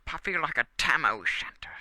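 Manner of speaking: hammed-up Scottish accent, high and loud Och. Joking about a song for a crush, in a mock Scottish accent. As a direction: ham up a Scottish brogue. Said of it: "I lost the accent there".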